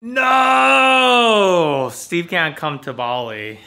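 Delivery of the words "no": long, high, loud cry of no; drawn-out melodramatic shout of despair; mock wail. Friend lamenting Steve missing Bali, mock wailing.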